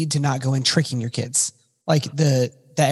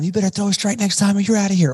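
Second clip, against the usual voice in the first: fast, higher mock telling-off, not truly shouted; mock screaming coach; 6 semitones higher, faster than usual. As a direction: imitate a coach laying into a player. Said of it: "doesn't go out screaming at them".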